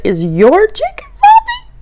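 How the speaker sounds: the second time, pushed up high and drawn out; insistent high question. Retold or imitated. Asking across the table again, insistent and high. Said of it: "lean across the table and say".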